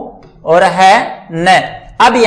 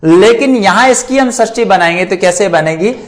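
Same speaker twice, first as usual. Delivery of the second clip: ordinary delivery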